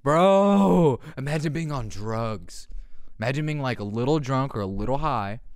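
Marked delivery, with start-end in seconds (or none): 0.0-1.0 loud exclaim. Real, not acted. Reacting after laugh.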